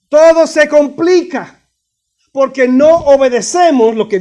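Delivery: loud, emphatic pulpit delivery; forceful preaching. Real, not acted. Preacher warning church about disobeying authority, forceful preaching. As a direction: proclaim it with weighty stressed words.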